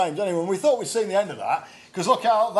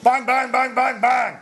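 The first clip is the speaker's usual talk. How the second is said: shouted bangs; 6 semitones higher, 5 dB louder than usual. Pretend. Mock gunshots at silly names.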